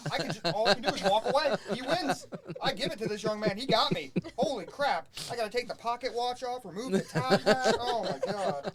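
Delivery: embarrassed actor laughing through the tale; laughing while telling. Real, not acted. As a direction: tell it cracking up.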